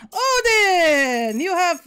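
high loud drawn-out Odin!; delighted cry. Real, not acted. Player thrilled a traveler is named Odin. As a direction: call out the name in delight.

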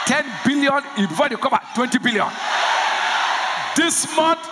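loud, high preaching climax; full-force preaching. Real, not acted. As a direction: shout it out to the church.

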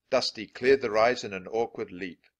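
ordinary delivery, nothing marked

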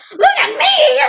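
high, loud, gleeful shout with a laugh in it; laughing yell. Acted. Pig pushing past Trevor at the shoot, a high laughing yell. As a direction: push past and yell it, cackling.

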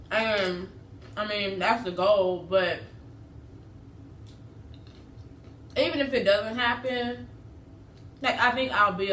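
chewing while talking; speaking between bites; mouth full of taco. Real, not acted. Seen 1: Bites the taco, then chews with puffed cheeks while speaking.

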